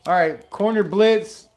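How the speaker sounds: live play-by-play burst; excited play call. Real, not acted. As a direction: call the blitz as it happens.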